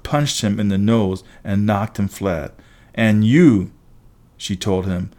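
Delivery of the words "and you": louder, pointed address; single him out firmly; stern warning. A narrator's reading. Girl turning on boy she punched, stern warning.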